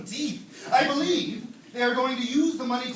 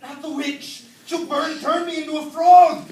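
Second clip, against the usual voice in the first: mock panic; 5 semitones higher, 8 dB louder than usual. Panics at mention of witch.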